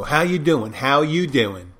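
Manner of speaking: put-on Philly-Jersey accent, punched then drawn out; imitating a New Jersey local; New Jersey-Philly greeting. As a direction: do a cocky Jersey "how you doin'". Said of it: "New Jersey, Philadelphia region and stuff, and they always go".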